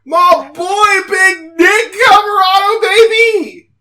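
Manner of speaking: loud, high hype shout; excited cheer. Real, not acted. Fan co-host hailing his favourite wrestler's entrance, excited high shout. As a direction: cheer on your favourite at full pitch.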